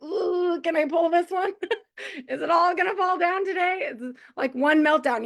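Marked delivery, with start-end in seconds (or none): 0.0-3.9 high, giggly mock-nervous voice, laughing through it; imitating a frazzled mom half-laughing; laughing mock worry. Mom to friends, laughing mock worry at the teetering pile. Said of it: "We're like".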